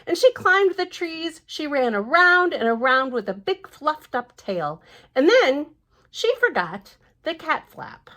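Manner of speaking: lively storybook reading voice; picture-book narration; reading to children. Acted. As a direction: read it out brightly to little ones. Reads the book aloud.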